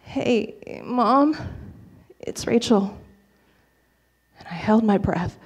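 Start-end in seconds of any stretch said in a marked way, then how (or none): 0.0-3.0 small, soft, halting greeting; hesitant, hushed phone hello after a long silence; timid croak. Retold or imitated. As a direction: croak it out quietly, nervous to be calling. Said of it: "I managed to croak out".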